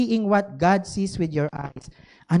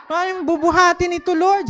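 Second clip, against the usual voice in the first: higher, faster, rising panic; worried plea; 8 semitones higher than usual. Retold or imitated. Pastor fretting to the Lord about the mall crowd, higher and rushed.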